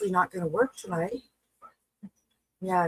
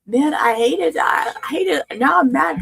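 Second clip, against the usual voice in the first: loud, high, frustrated outburst; frustrated shout; 9 semitones higher, 6 dB louder than usual. Real, not acted. Streamer shouting at the broken stream in frustration.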